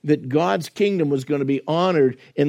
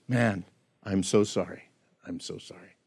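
soft, low "I'm so sorry", fading to a whisper; broken apology; 5 semitones lower, 9 dB quieter than usual. Retold or imitated. Preacher voicing a truly repentant sinner, whispering brokenly. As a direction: whisper the second sorry as if heartbroken.

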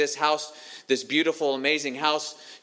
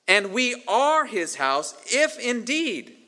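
slow, higher-pitched stress on "are" and "if indeed"; emphatic rereading; 6 semitones higher, slower than usual. Shown as a demonstration. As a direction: lean on "are", then lift "if indeed" high. Rereads verse to stress its condition.

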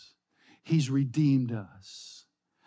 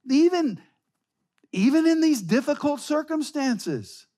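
higher, ringing preacher's exhortation; impassioned preaching; 11 semitones higher, 4 dB louder than usual. Acted. Preacher urging the congregation in hard times, fervent. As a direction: lift the congregation with urgent fervor.

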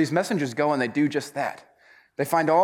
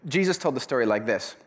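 ordinary talk

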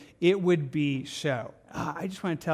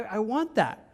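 usual speaking voice

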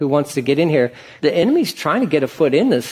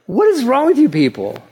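what is wrong with you; exasperated; 7 semitones higher than usual. Real, not acted. Pastor scolding the grumbling Israelites, exasperated.

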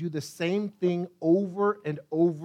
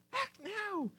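very high, hushed pleading; hushed plea; 13 semitones higher, 10 dB quieter than usual. Acted. Psalmist on the threshold of death, hushed urgent plea. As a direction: strain it out high and soft. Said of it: "It's a plea for God to act".

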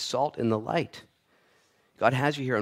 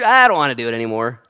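mock fed-up parent; 10 dB louder than usual.

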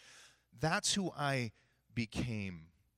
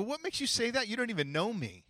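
mock-affronted, pitched up high; defensive retort; 10 semitones higher than usual. Client challenging the coach's verdict, defensive. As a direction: push back as if unfairly accused, voice jumping.

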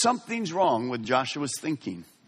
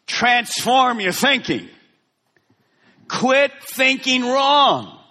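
higher, louder, slow and drawn out; emphatic slow command; 9 semitones higher, 4 dB louder, much slower than usual. Acted. God commanding Joshua to change, slow and forceful. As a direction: press every word home. Preacher voicing God's command.

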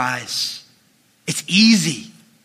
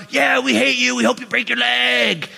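jeering fans; 6 semitones higher than usual. Retold or imitated. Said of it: "looking at a cardboard cutout".

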